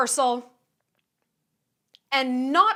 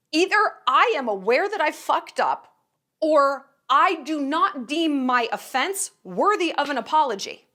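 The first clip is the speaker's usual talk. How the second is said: usual speaking voice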